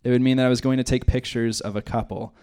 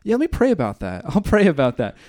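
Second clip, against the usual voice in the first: brushing off; 3 semitones higher than usual. Pretend.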